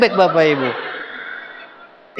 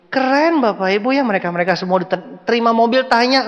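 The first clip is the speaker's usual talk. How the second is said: ordinary delivery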